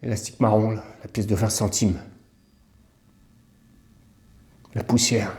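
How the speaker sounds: hushed, halting inventory with long pauses; quiet recollection. Real, not acted. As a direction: list it quietly, as if seeing it. Relives staring under the till.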